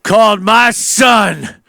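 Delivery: lightly voiced Biden rising to a shout; mock worked-up Biden. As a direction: ham up an old politician getting heated. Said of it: "gets all excited".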